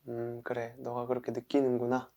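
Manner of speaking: soft, accepting murmur; quietly validate the feeling; acknowledging. Pretend. Speaker acknowledges their own feelings, softly accepting. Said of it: "그 다음에 인정해요".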